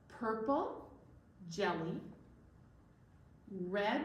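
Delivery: ordinary delivery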